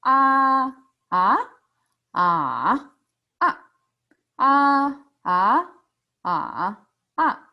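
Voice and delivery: loud "Ah" level, rising, dipping, short falling; four tones in turn. Shown as a demonstration. Teacher repeats the four tones, exaggerated and distinct. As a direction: drill "ah" through four Mandarin tones, twice over. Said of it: "Let's do it again".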